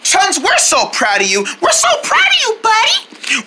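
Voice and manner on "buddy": normal talking voice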